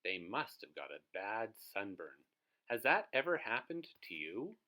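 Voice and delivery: ordinary delivery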